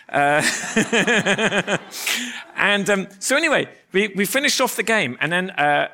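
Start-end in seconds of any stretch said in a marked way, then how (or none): 0.5-2.5 raised, bubbling laugh; real laugh. Real, not acted. Preacher amused by his own story, real laugh. Speaker laughs after telling the exchange.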